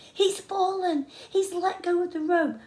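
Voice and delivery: high, loud alarmed shouting; kids shouting down. A narrator's reading. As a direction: call out in alarm from above. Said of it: "I heard them shouting above me".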